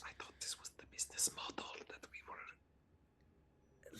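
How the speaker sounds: unvoiced, hushed; whispered aside. Real, not acted. Player whispers to Arabelle about the business model. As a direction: whisper it so she can't hear.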